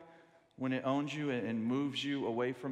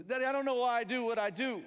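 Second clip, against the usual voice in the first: put-on higher, louder child voice pleading; child's plea; 9 semitones higher, 8 dB louder than usual. Little son pleading with his daddy, higher and louder. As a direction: plead like a troubled little boy.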